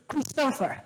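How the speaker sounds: botched mock accent on Christopher, high and breaking into a laugh; a badly faked accent saying the boy's name; terrible accent. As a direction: try a bad accent on Christopher and crack up. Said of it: "that's a terrible accent trying to make Christopher".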